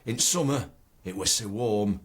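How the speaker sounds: broad Ilkeston poem recital; Ilkeston dialect. Shown as a demonstration. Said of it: "written in the Ilkeston dialect".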